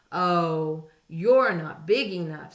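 loud, scornful brush-off; grouchy dismissive. A narrator's reading. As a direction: wave them off loudly. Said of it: "said the grouchy ladybug".

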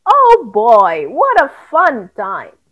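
plain reading voice

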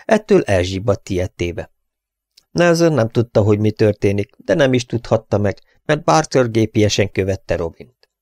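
usual speaking voice

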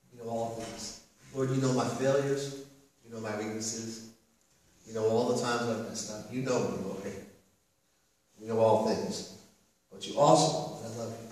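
hushed, low, prayerful voice; very quiet, intimate admission to the Lord; humble confession. Acted. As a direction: confess softly, leaving room between phrases. Peter confessing failures to Jesus.

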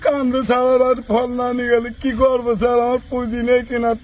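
tearful, wailing plea; sobbing lament; crying. Real, not acted. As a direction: plead helplessly through sobs. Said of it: "তুই কান্নাকাটি করিস না ভাই".

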